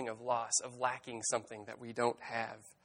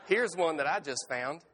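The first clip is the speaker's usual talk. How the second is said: louder, higher storybook raccoon; raccoon character; 4 semitones higher, 6 dB louder than usual. A narrator's reading. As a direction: offer a find as a folksy raccoon.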